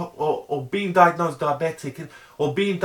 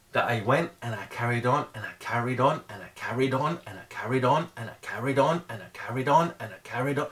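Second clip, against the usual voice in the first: droning the phrase over and over, softer; repetitive emphasis; 7 semitones lower, 5 dB quieter than usual. Shown as a demonstration. To viewers, about persevering, soft relentless repetition. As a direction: repeat it until it sinks in. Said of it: "I'm trying to really emphasize".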